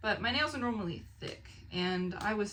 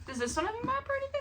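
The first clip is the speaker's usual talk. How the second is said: excited; much faster than usual. Real, not acted. Excitedly checking new book for map.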